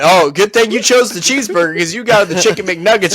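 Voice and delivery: ordinary talk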